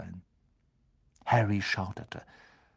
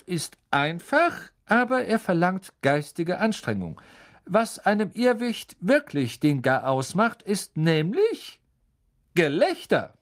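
higher, louder friendly professor voice; kind teacher; 8 semitones higher, 4 dB louder than usual. A narrator's reading. Teacher explaining the spell to the class, higher and friendly. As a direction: explain patiently like a gentle teacher.

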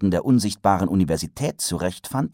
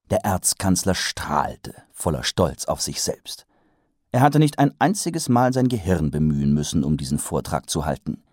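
plain reading voice

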